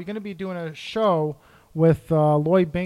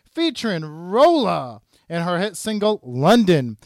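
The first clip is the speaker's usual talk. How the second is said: upbeat promo announcer read, long drawn-out show title; radio ad read; 3 semitones higher, 5 dB louder than usual. Acted. Host promoting the soundtrack release, punchy announcer read. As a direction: sell the release like a radio spot.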